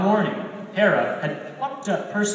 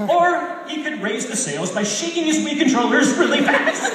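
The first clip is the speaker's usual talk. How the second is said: higher and louder, giggling through the line; laughing while reading; 9 semitones higher, 6 dB louder than usual. Real, not acted. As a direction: break into laughter at the image. Laughs at Leo's controls.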